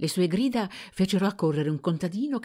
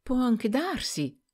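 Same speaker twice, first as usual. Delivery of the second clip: slow, trembling, higher admission; stammering in shame; 9 semitones higher, slower than usual. A narrator's reading. Girl admitting to her master, slow and stammering. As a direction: falter through it, ashamed. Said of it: "Rose balbettò".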